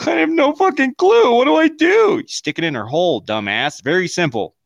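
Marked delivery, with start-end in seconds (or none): 0.1-2.2 higher, louder clueless panic; mock panic. A clueless guy in front of a girl, mock panicked. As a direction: squeal out helpless confusion. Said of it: "they're like".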